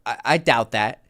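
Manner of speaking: imitated stammer on the first word; retold stutter. Woman answering a flirty challenge, retold stutter. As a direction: trip once on the opening I, as if caught off guard. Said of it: "And she stutters. I remember this".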